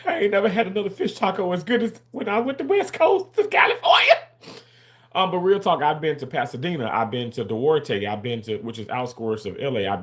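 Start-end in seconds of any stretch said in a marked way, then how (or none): 0.0-4.1 much higher, louder rave; excited high-pitched praise; raving. Real, not acted. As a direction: gush about the best taco ever. Raving about fish tacos.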